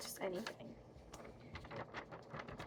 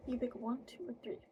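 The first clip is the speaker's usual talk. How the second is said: ordinary talk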